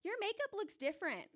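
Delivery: imitating a nosy fifth grader; ten-year-old kid. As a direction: chirp it like a blunt child. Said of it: "ten-year-olds being like".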